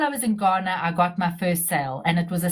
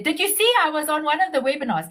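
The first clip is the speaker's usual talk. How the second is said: a light, mock Polish accent, pitched higher; imitating Polish accent; 6 semitones higher than usual. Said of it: "in her Polish accent".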